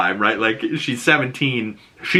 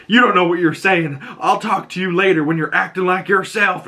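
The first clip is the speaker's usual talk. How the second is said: shaken dad; 9 semitones higher than usual. Retold or imitated. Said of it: "gets shook in the face and says".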